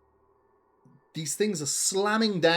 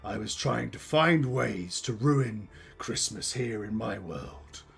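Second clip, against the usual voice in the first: theatrical villain monologue with deliberate pauses; bitter villainous curmudgeon. Acted. Curmudgeon explains the plot against Christmas, menacing monologue. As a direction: spit out the hatred, then recount it ominously. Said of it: "I am the Christmas curmudgeon".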